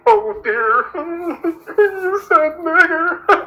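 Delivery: high, hammed-up tearful wail; mock crying. Pretending to be hurt by what Tina said, mock crying. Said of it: "look at Tina and say".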